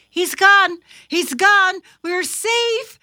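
high, loud, jubilant shouts; relieved excitement. A narrator's reading. Thumper to family as dog leaves, excited relief. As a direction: burst out with the good news. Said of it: "said Thumper".

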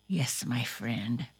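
ordinary delivery, nothing marked

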